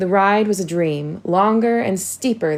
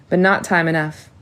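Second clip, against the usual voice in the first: ordinary delivery, nothing marked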